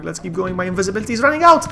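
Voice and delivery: rising, sped-up alarm; panicked. Real, not acted. Gamer warning that invisibility is running out, panicked. Panicking as game power runs out.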